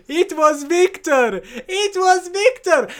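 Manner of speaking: high, slow, loud proud announcement; triumphant reveal. Retold or imitated. Gupta revealing the hero to the crowd, triumphant and high. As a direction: proclaim it like the big reveal. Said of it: "holds up the photocopies and goes".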